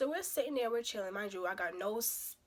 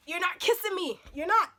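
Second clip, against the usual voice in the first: high, loud refusal; adamant refusal; 8 semitones higher, 6 dB louder than usual. Acted. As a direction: shut down the kiss, pitch raised. Acted refusal of a kiss.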